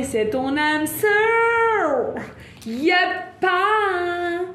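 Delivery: high, drawn-out gleeful cry; excited singsong. Real, not acted. Tarot reader revealing the soulmate card, excited singsong. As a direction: stretch the last word into a sung whoop.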